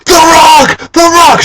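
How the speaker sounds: reenacted high fan shriek; excited screaming. Fan grabbing girlfriend by the leg, high excited screaming. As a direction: scream the name in disbelief. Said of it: "I'm screaming".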